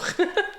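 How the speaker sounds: quick high giggle; a bright little laugh at herself; short laugh. Real, not acted. Laughs at own joke.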